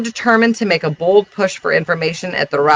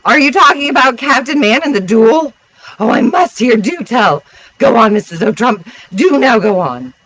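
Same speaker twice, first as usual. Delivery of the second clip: eager feminine interloper, pitched up; mild scream; 5 semitones higher, 4 dB louder than usual. A narrator's reading. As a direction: cry out excitedly, begging for the story. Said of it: "Here screamed some little feminine interloper".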